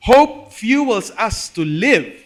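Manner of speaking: slow, loud, every word weighted; emphatic proclamation. Acted. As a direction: lay down the key line slowly and loudly. Sermon key line.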